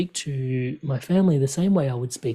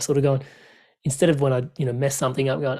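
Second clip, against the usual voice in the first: ordinary delivery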